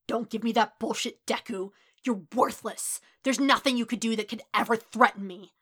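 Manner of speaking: contained rage, no longer shouting; thick, venom-laced anger; venomous. A narrator's reading. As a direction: spit contempt without yelling. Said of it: "Kacchan's volume had fallen, but that rage ... thickened in his venom-infused voice".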